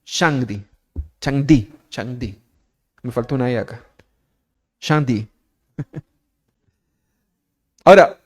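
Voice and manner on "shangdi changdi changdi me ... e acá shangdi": Shangdi tried as shang-di, then changdi; fumbling the Chinese Shangdi. Shown as a demonstration. Preacher fumbling the Chinese name for God before the congregation. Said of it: "sabe cómo se dice".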